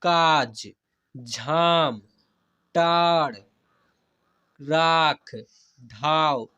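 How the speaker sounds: each word separate, loud, with a long held आ; pronunciation drill. Shown as a demonstration. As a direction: call out one stretched word at a time, pausing between. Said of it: "उच्चारण करना सीखिए". (एक-एक शब्द ज़ोर से, आ खींचकर)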